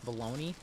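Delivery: bologna said as buh-LOH-nee; sandwich-style pronunciation. Shown as a demonstration. Streamer doubts the club is said like the sandwich, bologna as baloney. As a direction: say bologna like the lunch meat, baloney. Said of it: "Is it really pronounced".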